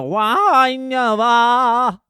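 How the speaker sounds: high, loud drawn-out wail; mock wailing singer noise. Comic mimicking the singer's second wail. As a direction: howl it like an arty singer. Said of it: "makes another".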